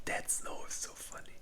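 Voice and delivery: ordinary talk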